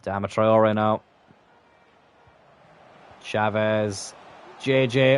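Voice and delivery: usual speaking voice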